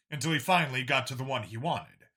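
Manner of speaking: plain reading voice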